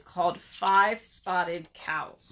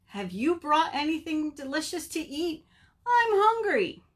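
hungry pleading; 8 semitones higher than usual. Acted. Hungry Bird asks Beaver for food, pleading. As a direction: plead high, then wail the hunger.

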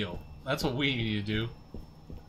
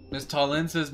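usual speaking voice

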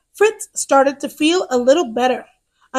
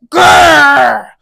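loud playful growl; monster growl; 7 dB louder than usual. Acted. Monster noise.

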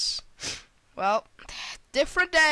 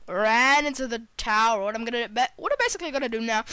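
ordinary delivery

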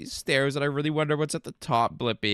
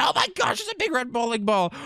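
high-pitched startled cry; playful alarm; 7 semitones higher than usual. Pretend. Player warning of a big bowling ball, high mock panic.

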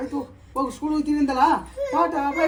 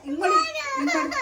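playful coaxing laugh; faster than usual. Real, not acted. Showing the child how to fold hands.

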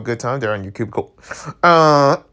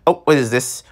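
ordinary delivery

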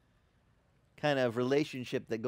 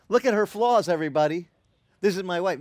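louder, mocking husband showing off flaws; wrong way, loud; 3 semitones higher, 7 dB louder than usual. Shown as a demonstration. Pastor showing husbands the wrong way, loud and mocking. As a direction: announce "Look at her flaws" like a public complaint. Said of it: "you can't say".

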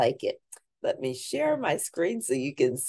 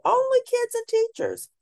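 put-on much higher, sing-song kid rule; high child voice; 5 dB louder than usual. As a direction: chant it like a bossy little kid.

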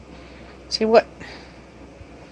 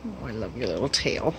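soft cooing; 9 semitones lower, 14 dB quieter than usual. Real, not acted. Cooing to pet.

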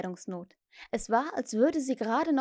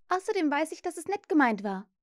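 higher, gently giving in; conceding; 7 semitones higher than usual. A narrator's reading. Wendy gives in to Peter after his mistake, conceding. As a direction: give way, in a higher girl's voice. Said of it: "sagte sie nachgiebig".

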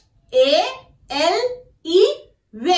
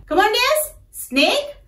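ordinary talk

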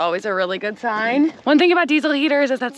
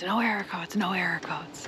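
lower, hushed repeat to herself; whispered relief; 5 semitones lower, 11 dB quieter than usual. Real, not acted. Checking the heater display, whispered relief. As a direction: breathe it out, barely voiced.

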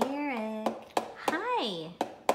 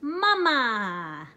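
"Mama" sounded out louder, drawn long; demonstrating a word; 9 dB louder than usual. Shown as a demonstration. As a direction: say "Mama" slowly and clearly for a toddler to copy. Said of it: "I want you to say".

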